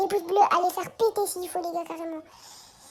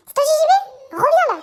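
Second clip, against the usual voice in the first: loud playful call to a teammate; 7 semitones higher, 6 dB louder than usual. Real, not acted. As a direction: call out across the room, teasing. Calling teammate in game.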